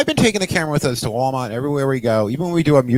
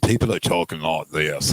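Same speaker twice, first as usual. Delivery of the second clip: put-on low, soft dropped-R American accent; 10 semitones lower, 5 dB quieter than usual. Showing how settlers' speech changed, dropped-R American accent. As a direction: drop the R's in a lower American accent. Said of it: "people are dropping R's".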